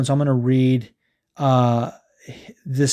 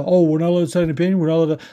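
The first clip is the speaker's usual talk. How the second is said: higher, rushed mock protest; mocking complainers; 3 semitones higher, much faster than usual. Host imitating people who claim they're silenced, mocking. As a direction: rattle off a mimicked complaint. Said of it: "that's what these motherfuckers are, are, are saying".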